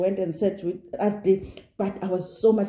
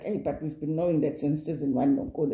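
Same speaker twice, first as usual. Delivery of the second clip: dry dismissal; 6 semitones lower, 5 dB quieter than usual. Retold or imitated. Dismissing castmate's tears.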